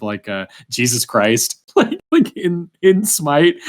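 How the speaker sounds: ordinary delivery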